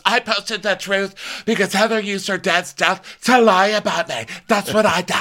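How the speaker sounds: high-pitched indignant justification; mock defiant outburst. Lisa defends her Twitter post, high defiant outburst. Acting out defiant answer in quarrel.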